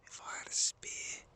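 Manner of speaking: barely audible wildlife-watcher's whisper; very faint, secretive whisper. Real, not acted. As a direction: whisper so the deer doesn't notice you. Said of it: "That's why I'm whispering".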